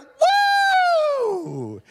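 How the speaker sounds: very high, loud, sustained "woo"; mock wild congregation cheer; imitated crowd whoop.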